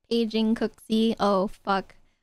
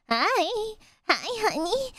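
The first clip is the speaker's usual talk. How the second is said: high sugary greeting to a sweetheart; cutesy baby voice; 9 semitones higher, slower than usual. Acted. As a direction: coo it like a baby. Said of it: "how to say it cutely".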